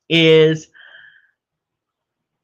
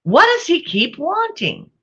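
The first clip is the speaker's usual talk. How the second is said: grandma asking; 12 semitones higher than usual. Retold or imitated. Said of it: "she goes".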